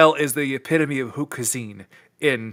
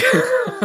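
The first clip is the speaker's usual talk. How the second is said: very high, short laugh; brief high-pitched giggle. Real, not acted. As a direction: let out a quick high laugh. Laughs after dismissing the movie.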